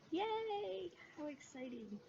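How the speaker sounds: a high, delighted squeal; excited yay. Real, not acted. Opening her quarantine box to viewers, she squeals with delight. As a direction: cheer it out high with real delight.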